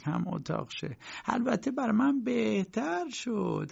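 high-pitched chatty landlady; landlady character, gossiping. A narrator's reading. Landlady telling K about the lodger next door, high gossiping voice.

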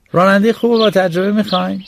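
put-on boy hawking himself as a driver; eager boy. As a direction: offer yourself at each door. Boy begs at new door.

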